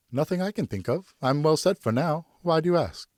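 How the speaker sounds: innocently deflecting the question; wife's coy reply. Acted. Wife Geneviève answers at dessert.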